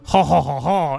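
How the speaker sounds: mock French laugh. Shown as a demonstration. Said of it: "you have to add in".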